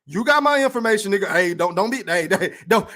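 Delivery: ordinary talk, nothing marked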